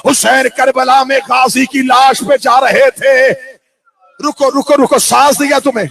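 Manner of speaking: loud, building cry of lament; impassioned majlis preaching. Acted. Preacher tells mourners of Abbas falling, anguished full-force declamation. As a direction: drive the tragedy home at full voice.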